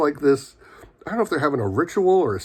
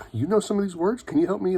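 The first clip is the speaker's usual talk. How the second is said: eager and high; 8 semitones higher, faster than usual. Retold or imitated. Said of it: "And she's like".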